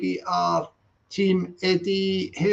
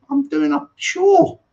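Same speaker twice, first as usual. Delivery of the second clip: exasperated outburst; 9 semitones higher, 5 dB louder, slower than usual. Real, not acted. Exasperated at drilling.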